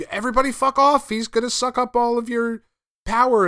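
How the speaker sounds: high, loud mock Batman yell; exasperated Batman. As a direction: ham up a fed-up Batman telling everyone off. Exasperated Batman voiced in recap.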